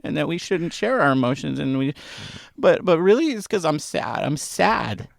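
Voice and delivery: confessing sadness about the Giants to his brother; mock sadness. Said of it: "I'm actually sad".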